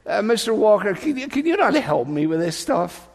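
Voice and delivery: put-on high, pleading boy voice; begging schoolboy. Pupil begging art teacher Mister Walker for help, high and pleading. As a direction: beg the teacher like a desperate pupil. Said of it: "I used to beg with him".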